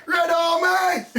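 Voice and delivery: high, loud, drawn-out terrace cry; joking fan chant, stretched out; playful football shout. Pretend. Football fan cry amid laughter.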